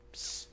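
hissed psst in the ear; a sly secret psst; tempter's hushed psst; whispered psst. Shown as a demonstration. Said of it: "It's a whisper".

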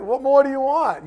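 loud, high exasperated dad; a parent fed up, shouting it out; mock exasperated father. Preacher voicing a fed-up father, loud and exasperated.

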